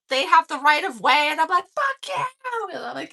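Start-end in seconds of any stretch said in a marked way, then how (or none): 1.8-2.2 indignant high-pitched retort; retold defiant yell. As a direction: imitate yelling at a cab. Retold yelling at taxis.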